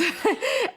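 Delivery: a quick high giggle; short laugh. Real, not acted. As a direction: laugh briefly at the memory, pitch jumping up. Laughing about first makeup.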